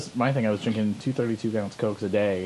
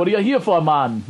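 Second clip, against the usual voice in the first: higher, louder mock Jamaican; badly faked Jamaican accent; 5 semitones higher, 5 dB louder than usual. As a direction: push the shaky Jamaican impression harder. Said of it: "It was supposed to be Jamaican".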